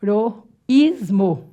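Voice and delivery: "heroísmo" slowly split into e-ro-ís-mo; syllable by syllable. Shown as a demonstration. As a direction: pause between the syllables of "heroísmo". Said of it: "separando a sílaba".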